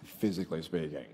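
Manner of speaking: a low, quiet aside that melts into a laugh; laughing punchline. Real, not acted. Speaker jokes about his cousins, laughing softly. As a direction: drop it softly, then laugh.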